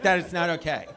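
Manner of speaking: lightly voiced stern teacher, "That is not okay" said evenly; a hint of a disapproving teacher. Comic mimics a teacher scolding the audience, lightly. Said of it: "looking at me like a teacher".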